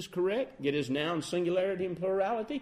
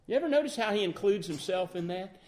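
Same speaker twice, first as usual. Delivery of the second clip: usual speaking voice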